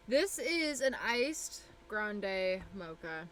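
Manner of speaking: very slow, stretched words; drawn-out delivery. Real, not acted. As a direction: savour each word slowly. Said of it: "I don't know why I said it like that".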